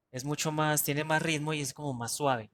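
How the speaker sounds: ordinary delivery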